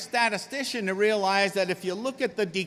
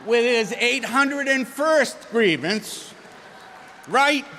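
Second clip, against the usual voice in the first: louder, higher, slow exasperated mock shout; mock exasperation; 6 semitones higher, 7 dB louder, slower than usual. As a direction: ham up the dread of the endless complainer. Mock exasperation at chronic complainer.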